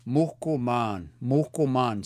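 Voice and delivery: "Muhkuman" said slowly with a breath of H after "Muh"; careful pronunciation demo. Shown as a demonstration. Cree teacher modelling a word for learners, careful and deliberate. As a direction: model "muhkuman" firmly, the H audible before the K. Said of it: "When you see that H, make this sound".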